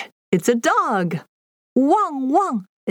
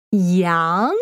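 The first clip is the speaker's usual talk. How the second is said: ordinary delivery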